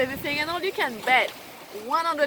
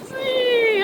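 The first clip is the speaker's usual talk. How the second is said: much higher, louder squeaky swoosh; paddle swinging sound; 14 semitones higher, 7 dB louder than usual. Retold or imitated. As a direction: whoop it like the paddle falling away.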